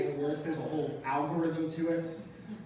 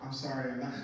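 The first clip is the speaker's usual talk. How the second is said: meek reply; 6 semitones lower, 5 dB quieter than usual. Retold or imitated. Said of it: "'cause I said".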